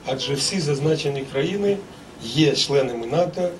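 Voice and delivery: ordinary talk, nothing marked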